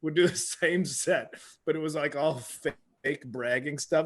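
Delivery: usual speaking voice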